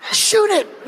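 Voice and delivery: high barked command "Shoot it."; soldier's order. Retold or imitated. Soldier ordering Kevin to shoot, high barked command.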